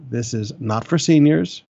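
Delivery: ordinary talk, nothing marked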